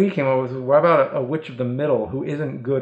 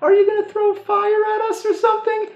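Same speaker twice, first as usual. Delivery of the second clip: indifferent witch. Acted. Witch questioned and answers.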